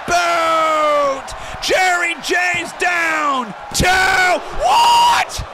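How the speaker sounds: shouted, hyped wrestling commentary; live play-by-play. Acted. Streamer calls the title match finish for chat, shouting and hyped. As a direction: scream the big moments like a ringside caller.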